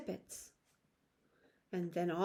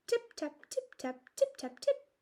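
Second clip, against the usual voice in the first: tiny tapping; 13 semitones higher than usual. A narrator's reading. Chorus of mouse tappings.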